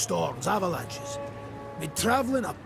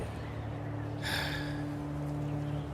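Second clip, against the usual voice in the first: long, soft sigh; heavy sigh; 8 dB quieter than usual. Acted. Culprit before the queen, about to confess, heavy sigh. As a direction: let out a long, quiet breath.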